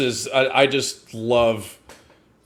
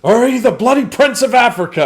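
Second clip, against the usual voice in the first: mock a recruit, building to a higher, louder pitch; badass Irish sergeant; 6 semitones higher, 6 dB louder than usual. Host acting out the sergeant taunting Thomas in training, mocking drill sergeant. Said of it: "The most badass Irishman on the planet".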